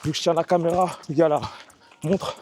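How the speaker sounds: breathy words between strides; while running. Real, not acted. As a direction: talk while jogging, slightly winded. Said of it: "Je vais essayer de parler en courant". (en courant, un peu essoufflé)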